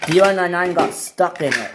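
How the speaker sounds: plain reading voice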